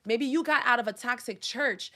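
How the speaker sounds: ordinary delivery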